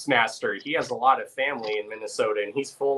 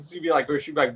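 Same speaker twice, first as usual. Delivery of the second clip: normal talking voice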